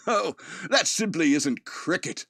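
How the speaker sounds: slow, hammed-up British drawl on "Oh, that simply isn't cricket."; joking that he wakes up at his own funeral with a British accent; mock posh British accent. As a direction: play a prim Englishman, slowly and lightly mocking. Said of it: "all of a sudden I have a British accent".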